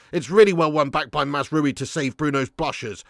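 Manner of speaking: ordinary talk, nothing marked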